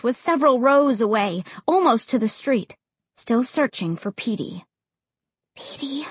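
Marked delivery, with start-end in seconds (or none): 5.5-6.1 breathy whispered name. A narrator's reading. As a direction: whisper it in dread. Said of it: "I whispered".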